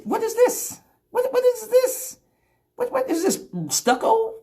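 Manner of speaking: high, slow what-is-this in disgust; disgusted shock. Retold or imitated. He finds something odd under his nails and squeals in disgust. Reacting in disgust, mock horror.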